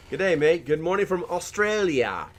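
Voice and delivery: cheery fake Australian twang; bad Australian accent. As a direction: greet them in a terrible Australian accent. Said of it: "it's the worst Australian accent ever".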